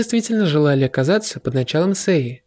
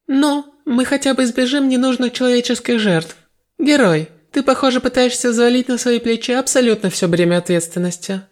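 queen's voice; 8 semitones higher than usual. A narrator's reading. Queen addresses hero.

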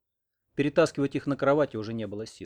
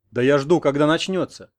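louder, impatient comeback; loud retort; 3 semitones higher, 9 dB louder than usual. A narrator's reading. Grandfather answers the wife who scolds him, loud retort. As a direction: answer back loudly, on the defensive.

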